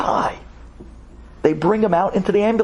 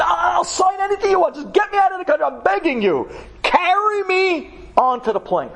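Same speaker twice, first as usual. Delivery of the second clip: high, strained pleading; desperate begging; 13 semitones higher than usual. Retold or imitated. Terrified man begging security to carry him onto the plane, high. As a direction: beg for your life.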